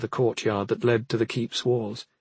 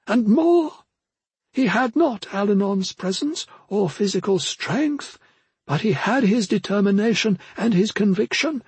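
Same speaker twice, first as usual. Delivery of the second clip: put-on solemn old mentor; 11 semitones higher than usual. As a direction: intone like an otherworldly elder, pitched well up.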